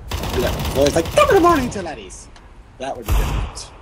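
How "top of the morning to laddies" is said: high, hammed-up Irish greeting; exaggerated Irish accent. Shown as a demonstration. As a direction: overdo the Irish lilt, pitched way up. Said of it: "If I was going to say it in his".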